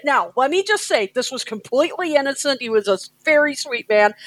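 ordinary talk, nothing marked

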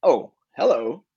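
performed greeting. Shown as a demonstration. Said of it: "give me your best oh hello".

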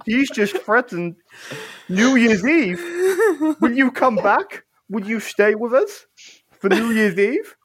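high-pitched, dismayed pleading voice; Fuzzy Jumper. Acted. Jumper begging the heroes to stay, high and imploring. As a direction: pitch it up and plead, close to laughing. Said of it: "Fuzzy Jumper goes".

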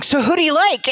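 high, excited mock-fan question; eager question. One metalhead asking another for favourite bands, eager. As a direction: ask it brightly, pitched well up.

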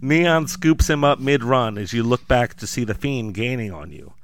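ordinary talk, nothing marked

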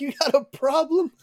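high, loud retold taunt; imitating someone picking a fight; confrontational challenge. Acting out stranger's challenge.

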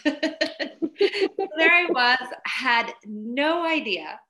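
slow, laughing while talking. Real, not acted. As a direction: let the laugh carry the words. Laughing at her own story.

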